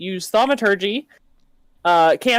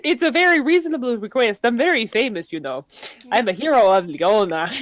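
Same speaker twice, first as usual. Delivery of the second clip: Swedish accent; 2 semitones higher than usual. Shown as a demonstration. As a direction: boast in a singsong Swedish accent. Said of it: "Or you could go Swedish".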